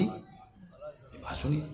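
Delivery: very soft, slow, breathy hush; hushed aside. Acted. Preacher hushing the audience before the key point, soft and slow. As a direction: lean in and hush the crowd.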